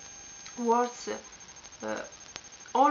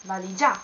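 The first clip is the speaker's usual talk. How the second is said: valigia with final GIA punched louder; stress on last syllable; 3 semitones lower, 6 dB louder, faster than usual. Shown as a demonstration. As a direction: say valigia wrongly as va-li-GIÀ. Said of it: "not valigia or".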